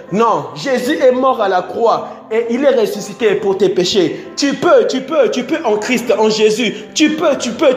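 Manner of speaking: ordinary talk, nothing marked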